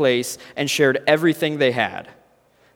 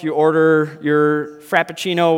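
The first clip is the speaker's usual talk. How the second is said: usual speaking voice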